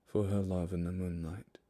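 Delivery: hushed storyteller reading a ballad; poem recital. Acted. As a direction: read it like a haunting ballad. Reads The Highwayman poem aloud.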